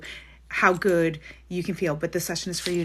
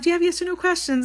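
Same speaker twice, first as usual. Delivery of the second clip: high, louder put-on questioning; retold client question; 9 semitones higher, 4 dB louder, faster than usual. Reader quoting herself asking clients for questions, high-pitched mock query. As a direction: ask it high and quick, as retold dialogue.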